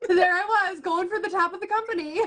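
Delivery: high, laughing. Real, not acted. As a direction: giggle through the irony. Laughing at her own rise.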